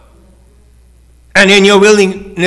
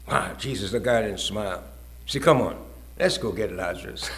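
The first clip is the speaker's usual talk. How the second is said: much softer, lower; hushed; 9 semitones lower, 15 dB quieter than usual. Real, not acted. Preacher hushing the room at Lazarus. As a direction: lean in and hush.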